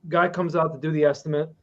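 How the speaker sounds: usual speaking voice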